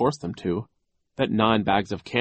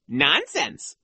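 high, mildly mocking echo; repulsive retort; 8 semitones higher than usual. A narrator's reading. Boy imagines mimicking the vice principal, mock repulsive. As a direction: throw it back with raised pitch. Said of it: "in Nero's own repulsive way".

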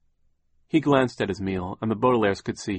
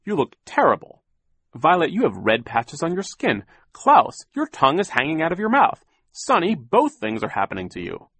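higher, louder, flustered Poe; a fussy banker fretting over the children; 7 semitones higher, 7 dB louder than usual. A narrator's reading. Banker fussing over the sick children, higher and louder. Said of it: "he said".

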